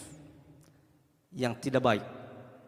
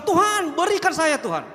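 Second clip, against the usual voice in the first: mock demanding prayer; 10 semitones higher, 4 dB louder, slower than usual.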